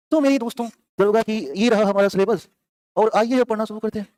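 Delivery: announcer intro. Acted. Teacher opening the class.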